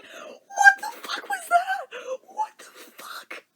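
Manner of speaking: slow, high disbelieving cry of What the fuck; baffled shriek. Retold or imitated. Still baffled years later, crying out what the fuck slowly and high. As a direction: stretch it out in shocked disbelief. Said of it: "And I'm still like".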